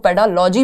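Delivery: पेडालॉजी with L replacing the middle g; L-sound variant. Shown as a demonstration. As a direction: say पेडालॉजी ending in "lo-jee". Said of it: "भी कह देते हैं".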